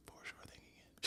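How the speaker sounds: whispered knowing aside; conspiratorial whisper. Real, not acted. Recapper confides to viewers about him, conspiratorial whisper.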